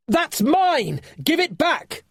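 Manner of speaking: high, loud protesting yell; a boy shouting at thieves to give it back. A narrator's reading. Finn yelling at the creatures who stole his armlet, high loud protest. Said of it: "Finn yelled".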